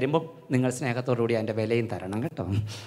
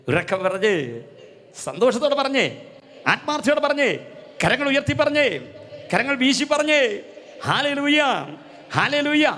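rousing call; 9 semitones higher, 4 dB louder than usual. Real, not acted. Preacher rousing congregation to hallelujah.

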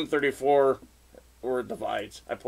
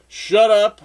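loud, high snap; mock-angry outburst, pitched up; retold angry yell; 7 semitones higher, 9 dB louder than usual. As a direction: snap it loudly, acting out a car argument. Retold quarrel with girlfriend in car.

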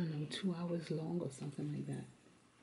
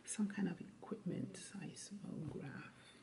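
soft unvoiced whisper; 6 dB quieter than usual. Real, not acted. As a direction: whisper it like an aside. Tutor whispers aside to child.

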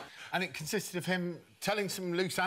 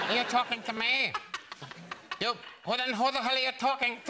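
De Niro impression; 7 semitones higher than usual. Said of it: "You sound like a villain in Danger Mouse".

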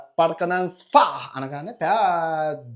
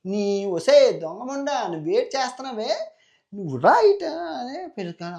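louder, higher furious yell at his sister; angry shout; 9 semitones higher, 5 dB louder than usual. Acted. Demon brother catches sister with Bhima, shouting angrily.

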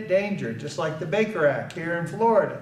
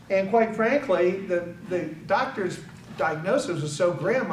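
ordinary delivery

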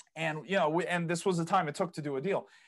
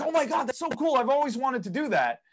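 higher, gushing oh-my-God voice; gushing excitement; 8 semitones higher, 4 dB louder than usual. Retold or imitated. Friend hearing about property plans, gushing excitedly. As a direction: imitate a friend gushing over the idea. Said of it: "when they get really excited".

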